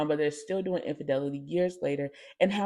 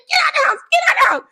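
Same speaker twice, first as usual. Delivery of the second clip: much higher, loud kicking-out shout; mom yelling; 10 dB louder, much faster than usual. Retold or imitated. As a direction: throw her out of the house. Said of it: "So she said".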